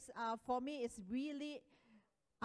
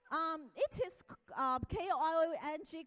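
ordinary talk, nothing marked

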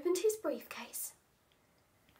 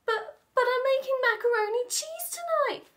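pleading; 12 semitones higher, 4 dB louder than usual. Acted. Daddy pleads, stammering.

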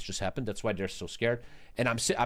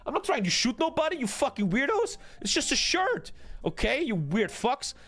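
retold outraged yell; 11 semitones higher than usual. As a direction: yell back at the whole class in disbelief. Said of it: "I'm like".

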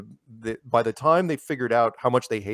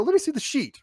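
startled demand; 14 semitones higher than usual. Retold or imitated. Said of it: "I go".

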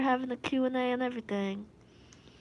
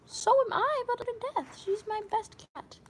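much higher, sweet voice; high cutesy voice; 10 semitones higher than usual. Acted. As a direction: squeak it out cutely. High cutesy voice about cat.

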